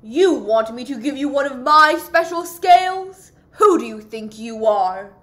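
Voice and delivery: loud, scornful outburst; proud fish loudly rejecting a request; indignant cry. Acted. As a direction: snap at the little fish, offended. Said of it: "cried the Rainbow Fish".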